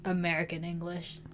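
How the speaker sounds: AMERICAN ENGLISH dropped down low and soft; low-pitched American English. Shown as a demonstration. Host downgrading their own American English, said down low. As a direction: sink AMERICAN ENGLISH low, as the lesser kind. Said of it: "down low".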